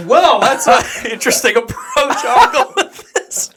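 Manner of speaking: high, laughing mock calm; laughing dry irony, slowing at the end; laughing sarcasm. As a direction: chuckle through a dry sarcastic aside. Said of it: "I was like".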